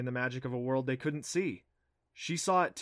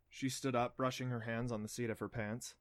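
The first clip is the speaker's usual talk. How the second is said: ordinary delivery, nothing marked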